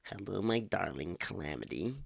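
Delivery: odd-timbred smarmy neckbeard greeting; mocking neckbeard chirp. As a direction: chirp it like a creep putting on airs. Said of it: "he chirped ... mocking accent".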